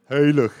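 "Heilig" with its first syllable said "hoy", like huilig; mispronounced Afrikaans. Retold or imitated. Preacher demonstrates the typo to church, "Heilig" misread as huilig. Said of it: "All the English people going".

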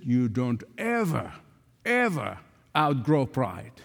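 low, slow, lightly imitated Oxford accent; deliberate Oxford English, pausing for weight; John Stott. Elderly mentor correcting his younger friend, measured Oxford accent. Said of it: "in his Oxford accent".

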